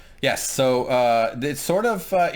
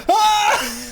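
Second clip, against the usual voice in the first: high, loud comic shriek; reenacted scream; 8 dB louder than usual. Host retelling a possum face-to-face, imitated scream. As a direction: shriek as if startled, half laughing. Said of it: "I screamed and I ran away".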